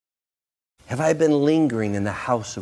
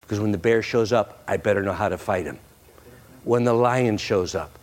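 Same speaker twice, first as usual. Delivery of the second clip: normal talking voice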